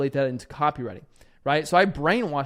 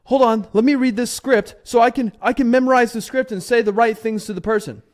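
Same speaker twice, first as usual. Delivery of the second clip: higher, louder mock flustered newbie; nervous script reader; 7 semitones higher, 8 dB louder than usual. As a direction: play the panicky caller grabbing his script. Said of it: "you're like".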